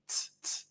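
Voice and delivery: "tss" as soft unvoiced tongue-tip clicks; whispered T sounds. Shown as a demonstration. Instructor demos the T tongue position to divers, soft unvoiced "tss". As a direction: breathe out "tss, tss" with the tongue tip on the upper teeth. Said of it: "If you're pronouncing the letter T".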